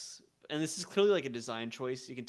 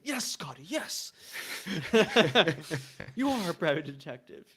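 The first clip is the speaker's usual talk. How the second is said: teasing, louder and higher, laughing through it; mock praise; 5 semitones higher, 7 dB louder than usual. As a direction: humour him like a proud kid. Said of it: "And I'm just like".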